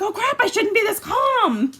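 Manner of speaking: high, loud alarmed cry; sudden alarm. Retold or imitated. Realizing she's too calm, a high loud alarmed cry. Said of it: "all of a sudden you're like".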